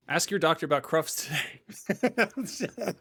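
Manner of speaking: slow, deliberate ad-read parody; mock drug ad voice. Podcast host parodying a pharma ad, slow ad voice. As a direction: read it like the tail of a drug commercial.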